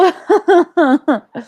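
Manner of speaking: loud giggling fit; laughing. Real, not acted. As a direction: burst of high laughter. Laughing after apology.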